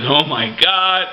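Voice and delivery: slow, drawn-out shocked gasp of words; stunned. Retold or imitated. Fan recalling the Undertaker losing, slow stunned disbelief. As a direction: replay the jaw-drop moment slowly. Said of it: "I was like this".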